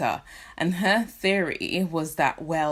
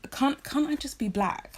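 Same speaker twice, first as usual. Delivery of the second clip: ordinary talk, nothing marked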